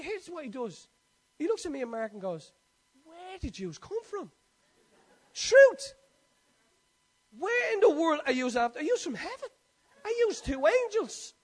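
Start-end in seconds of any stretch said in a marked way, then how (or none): 3.0-11.3 imitating the old man's amazement, getting louder and higher; amazed old man. Old man amazed at strangers' gift.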